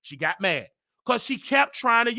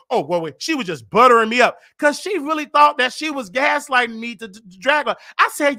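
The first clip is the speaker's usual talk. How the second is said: ordinary talk, nothing marked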